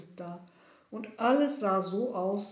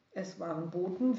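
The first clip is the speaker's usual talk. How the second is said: ordinary delivery, nothing marked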